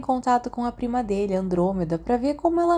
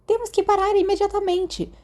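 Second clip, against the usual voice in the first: much higher put-on voice of a cousin halting a wedding; Narcisa stopping the ceremony; 11 semitones higher than usual. Sirius retelling Narcisa halting the ceremony, in a much higher mock voice. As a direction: pitch it way up as a prim young woman demanding a stop. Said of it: "ela se levanta na frente de todo mundo e diz".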